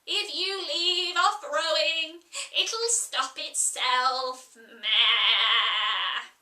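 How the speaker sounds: loud teasing with a closing bleat; mock the child like an old ewe; teasing Sheep. Sheep teasing Alice, loud, ending in a bleat.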